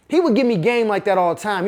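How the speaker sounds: normal talking voice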